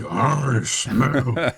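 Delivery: growly brute monster voice; Super Mutant voice. Retold or imitated. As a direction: voice a hulking mutant sniffing out prey. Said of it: "your Super Mutant voice is legendary".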